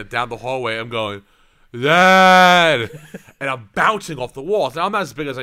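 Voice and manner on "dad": one long, loud call; drunken holler. Retold or imitated. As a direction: holler it down the hallway, drawn out. Calling out drunk in hallway.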